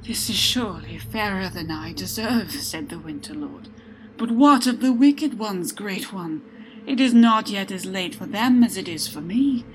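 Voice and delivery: humbled lord questioning the god; humbled Winter Lord. A narrator's reading. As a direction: ask for the wicked with urgency.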